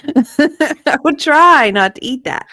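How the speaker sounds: laughing. Real, not acted. Grossed out by chocolate poo joke.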